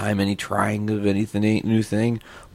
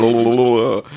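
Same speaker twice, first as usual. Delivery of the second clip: normal talking voice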